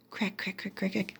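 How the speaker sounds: soft whispered cracking sounds. Acted. Narrator whispering the eggs hatching, hushed. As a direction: breathe the cracks like eggshells.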